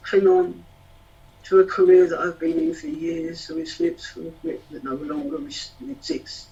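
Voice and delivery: rhythmic, emotional poetry performance; spoken word poem. Acted. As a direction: perform it like a slam poet.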